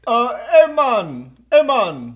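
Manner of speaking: exaggerated Jamaican greeting; Jamaican accent. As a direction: call it out in a put-on Jamaican accent. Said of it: "this is an impression".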